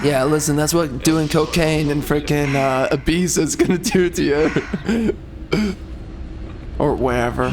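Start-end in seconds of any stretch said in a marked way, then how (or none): 5.2-6.7 a quiet, unvoiced laugh; soft laugh. Real, not acted. After his cocaine joke, a soft laugh. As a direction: let out a small laugh under the breath.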